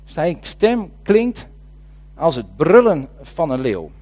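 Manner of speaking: "Zijn stem klinkt" drawn out very slowly, then "als het brullen van een leeuw" with weight on "brullen"; slow dwelt-on repeat. Shown as a demonstration. Preacher dwelling on the verse for the congregation, slow and weighty. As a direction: savour each word of the verse, slowly. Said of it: "En wat mij opviel is dit".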